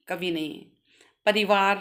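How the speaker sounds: ordinary talk, nothing marked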